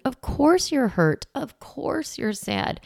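gently name the sadness, slowly; tender validation. Shown as a demonstration. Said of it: "So we're validating their emotion".

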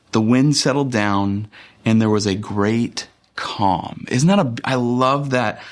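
ordinary talk

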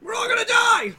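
high-pitched shriek of alarm; hammed-up hysterical news scare; mock panic. Parodying overreacting news about clowns, mock panic.

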